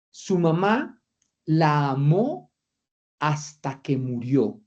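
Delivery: slow, with long pauses between phrases; pausas marcadas. Shown as a demonstration. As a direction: lay the riddle out word by word, slowly. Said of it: "hagamos aquí un ejercicio sencillo". (frase dicha despacio, con pausas largas)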